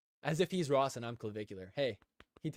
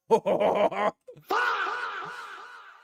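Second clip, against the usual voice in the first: big loud laugh; 6 dB louder than usual. Real, not acted. Reacting to quote.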